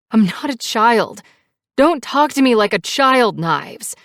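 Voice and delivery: high, loud, indignant protest; angry retort. A narrator's reading. Passenger refusing to be treated as child, angry retort.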